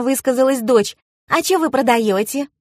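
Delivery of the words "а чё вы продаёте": put-on high-pitched child's question; young girl. A narrator's reading. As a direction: ask as a nosy daughter. Narrator voices curious daughter.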